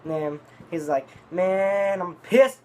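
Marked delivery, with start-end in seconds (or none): 1.3-2.6 loud, raised-pitch mock anger; heated. Man coming back from the store, imitated as heated. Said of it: "he was, like, fucking heated with me".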